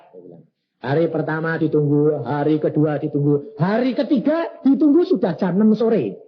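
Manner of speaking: normal talking voice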